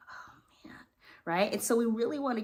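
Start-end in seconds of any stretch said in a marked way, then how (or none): 0.1-0.8 breathy whispered dismay. Retold or imitated. Student overwhelmed by imagined barking, whispered.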